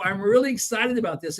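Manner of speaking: high, bright pitch "I'm really excited about this"; excited demo. Shown as a demonstration. Sales coach demonstrating how to sound excited. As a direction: show real excitement with your body. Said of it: "move your body in a way that would demonstrate excitement".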